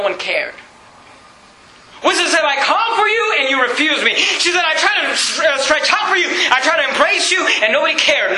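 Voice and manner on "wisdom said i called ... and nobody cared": high, pleading pulpit cry; full-force preaching. Acted. Preacher voicing Wisdom begging people to turn, full-force preaching. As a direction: preach it as an impassioned appeal, pitched up.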